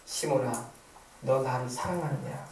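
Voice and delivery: low, soft, slow tender question; gentle. Acted. Jesus asking Simon Peter if he loves him, gentle. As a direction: ask it tenderly, hushed and unhurried.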